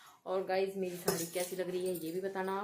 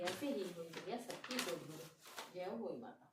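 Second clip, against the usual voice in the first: softer, lilting katha recitation; devotional story reading; 8 dB quieter than usual. A narrator's reading. Reading the Hoi Ashtami katha for the festival, softer storytelling voice. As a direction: read the sacred tale aloud, softly and evenly.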